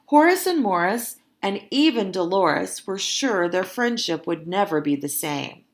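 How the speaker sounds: ordinary delivery, nothing marked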